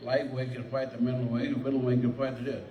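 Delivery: put-on proud old fighter reliving his tournament win, excited; excited rush. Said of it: "I was so excited".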